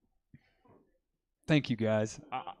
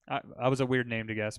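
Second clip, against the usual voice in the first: normal talking voice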